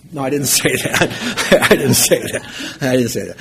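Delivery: real chuckle through the words; laughing denial. Real, not acted. Preacher retracting his joke to the audience, laughing denial. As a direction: take back the joke while laughing.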